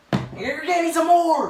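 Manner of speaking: high, loud angry demand. Real, not acted. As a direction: raise your voice and demand it. Angry victim demands replacement shoes.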